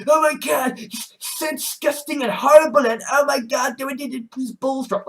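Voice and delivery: high whiny mock disgust; mocking hater. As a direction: mimic a shrill disgusted hater. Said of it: "just sort of like".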